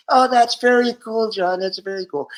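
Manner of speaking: high mimicked agreement; wife's approving reply. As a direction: imitate a high-voiced wife humoring her husband. Acts [name]'s reply to [name].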